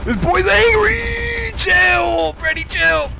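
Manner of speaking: high, loud panicked yells; scared shouts at Freddy; frightened shouting. Real, not acted. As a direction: yell at the monster to back off. Fleeing angry Freddy.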